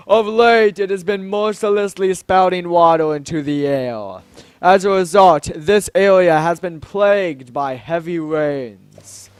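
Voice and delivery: stately exposition with punched openings; grand Zora king, grave. Acted. King describing the rains plaguing his land, grand and grave. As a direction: declaim the trouble like a monarch.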